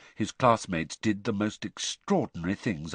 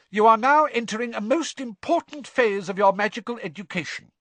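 high, emphatic schoolmistress; McGonagall; 12 semitones higher, 6 dB louder than usual. A narrator's reading. Professor addressing the groaning class, high and stern. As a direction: stress the importance loudly from the front. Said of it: "Professor McGonagall explained why".

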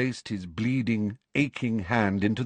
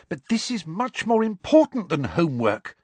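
Hermione insisting; 10 semitones higher, 4 dB louder than usual. Acted.

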